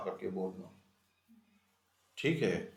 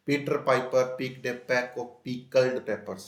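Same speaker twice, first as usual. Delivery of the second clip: slow, each P sound clear; careful slow recitation; slow tongue twister; 4 dB louder, much slower than usual. Shown as a demonstration. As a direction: spell out the alliteration slowly.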